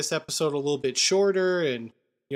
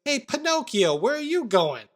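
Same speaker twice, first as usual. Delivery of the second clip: high mock call after a runaway; lightly voiced taunting chase; a hint of teasing shout; 8 semitones higher than usual. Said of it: "He goes".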